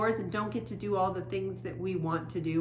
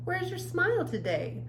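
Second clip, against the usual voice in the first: mom's voice; 12 semitones higher, 3 dB louder than usual. Acted. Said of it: "She fluffed my hair".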